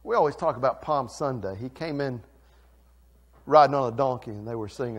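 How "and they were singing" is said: ordinary talk, nothing marked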